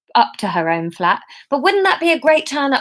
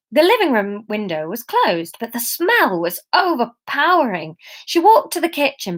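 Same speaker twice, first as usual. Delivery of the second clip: ordinary delivery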